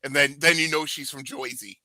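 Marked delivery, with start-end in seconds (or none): 1.2-1.8 "Jersey" said as "Joisey"; Jersey accent. Retold or imitated. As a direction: mimic New Jersey, turning "Jersey" into "Joisey". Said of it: "she doesn't have the Jersey accent out until she's mad".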